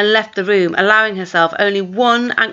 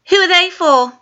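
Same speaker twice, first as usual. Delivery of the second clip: put-on demanding girl; 10 semitones higher, 3 dB louder than usual. Twin demanding.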